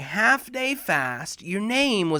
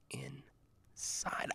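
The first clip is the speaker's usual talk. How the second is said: much lower, softer unvoiced whisper; 16 dB quieter than usual. Kamil revealing the secret, whispered. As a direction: drop to a secret. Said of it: "he says".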